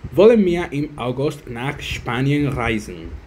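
slow, careful question; slow learner reading. Shown as a demonstration. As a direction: read it out slowly to practise. (lectura lenta de práctica)

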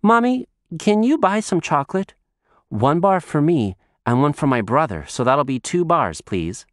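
put-on high, fast child chatter; girl running to the supermarket, high and quick; little girl voice. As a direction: chatter on like an eager small girl. Girl begs for chocolate.

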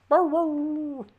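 very high, loud yappy bark; dog barking. Pretend. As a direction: yap it like a little dog.

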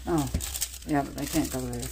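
ordinary delivery

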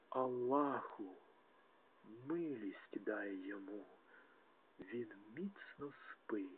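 a soft whispered plea for the dead; hushed prayer. Acted. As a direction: breathe the prayer to Allah almost silently.

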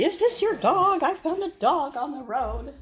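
high, excited call. Retold or imitated. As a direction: call out with the pitch way up. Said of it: "came screaming up the driveway to say".

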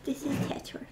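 soft unvoiced whisper; whispered introduction to the bunny. Real, not acted. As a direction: hush it, as if not to wake him. Whispering intro of pet rabbit.

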